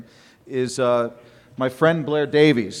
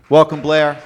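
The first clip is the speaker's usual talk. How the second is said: louder announcing welcome; 9 dB louder than usual. Real, not acted. Host calls up the speaker Blair with a loud welcome. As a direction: call the speaker up to the stage.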